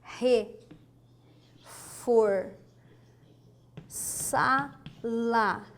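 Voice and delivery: "reforçá-la" very slow, long gaps between syllables. Shown as a demonstration. Teacher writing the example for students, "reforçá-la" syllable by syllable. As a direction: spell out "reforçá-la" one syllable at a time. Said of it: "Olha só".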